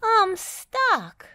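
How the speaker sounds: high, loud cry of distress; squealing dismay; wailing. A narrator's reading. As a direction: wail it out helplessly. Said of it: "wailed Dizzy".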